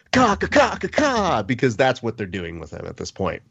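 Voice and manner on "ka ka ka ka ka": high-pitched taunting chicken squawks; mock chicken clucking. Host mimicking fighters who call a rival chicken, high clucking. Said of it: "calling McGregor a chicken".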